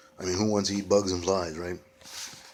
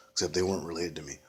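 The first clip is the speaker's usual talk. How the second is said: ordinary delivery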